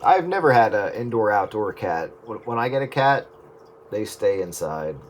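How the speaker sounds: ordinary delivery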